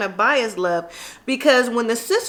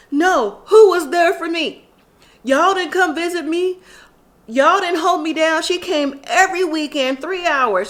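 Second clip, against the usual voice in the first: upset; 5 semitones higher than usual. Retold or imitated. Quaylen upset defending Chevelle.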